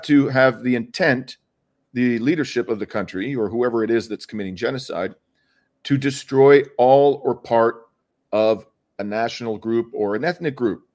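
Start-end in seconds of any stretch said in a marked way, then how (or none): 5.8-7.8 slow, louder, weighty emphasis on "part"; stress on PART. Real, not acted. Lawyer explaining the legal definition of intent, stressing "part". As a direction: spell it out slowly and firmly, landing on "part". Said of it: "I stress the word part".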